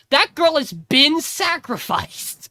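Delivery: each word slow and weighted, "sacrificed" hit hard; slow emphatic correction. Retold or imitated. Host correcting co-host's tense, slow emphatic. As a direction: spell it out slowly, leaning on "been sacrificed". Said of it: "She goes".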